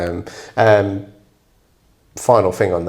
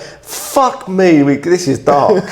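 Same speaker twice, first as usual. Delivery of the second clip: shocked; 4 semitones higher, much slower than usual. Real, not acted. Shocked reaction to monkey torture.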